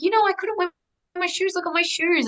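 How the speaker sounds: high, excited voice of the dead father showing his shoes; light and thrilled, like a child with new shoes; joyful dad. Retold or imitated. Dad in a dream shows daughter his new shoes, high and delighted.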